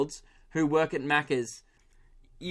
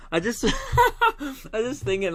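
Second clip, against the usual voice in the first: high, drawn-out laughing speech; 8 semitones higher, slower than usual. Real, not acted. Comic cracking up at his own thought, laughing. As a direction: laugh helplessly through the words.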